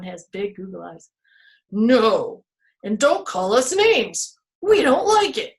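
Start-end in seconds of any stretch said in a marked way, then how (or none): 1.7-5.5 loud, rising, indignant tree voice; offended trees. Acted. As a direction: push back at the name-calling.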